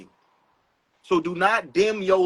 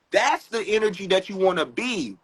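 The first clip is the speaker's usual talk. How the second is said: ordinary talk, nothing marked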